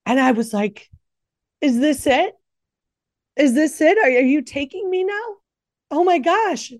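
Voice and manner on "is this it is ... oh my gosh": high, breathless asking in disbelief; retold fearful questioning. Telling listeners when she stopped breathing, high frightened questions. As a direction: ask it as if the end has come.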